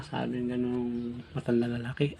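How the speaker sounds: ordinary talk, nothing marked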